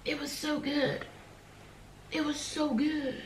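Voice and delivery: high, slow, dreamy; high swoony voice. Real, not acted. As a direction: swoon over it. Gushing about the book.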